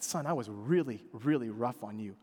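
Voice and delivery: low, soft, gentle confession; tender apology to son. Retold or imitated. Father apologizing to his son, soft and tender. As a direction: speak it softly to your boy. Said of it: "I said".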